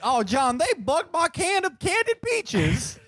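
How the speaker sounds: very high, slow Southern whine; hammed-up hillbilly accent. Parody of the Louisiana mobster, hillbilly accent. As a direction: wail it in a mock hillbilly drawl. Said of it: "who is a big hillbilly".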